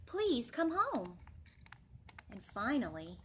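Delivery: put-on animated children's story voice; storytime reading. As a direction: read it to the class with big expression. Reads picture book aloud.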